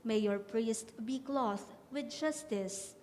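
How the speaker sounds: usual speaking voice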